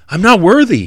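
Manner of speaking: a lightly voiced centurion protesting unworthiness; mild hint of a humble soldier. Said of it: "he'll say".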